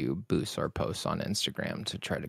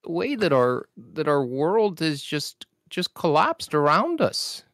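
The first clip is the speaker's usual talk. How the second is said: exasperated professor lamenting the world; Jordan B. Peterson impression; 7 semitones higher than usual. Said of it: "[name]'s impeccable per-, impression".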